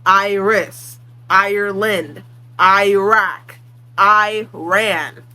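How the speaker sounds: "Iris, Ireland, Iraq, Iran" each starting on a punched "EYE"; emphasized I. Shown as a demonstration. Demonstrating the American I, each first syllable stressed. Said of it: "put a lot of emphasis on the I sound".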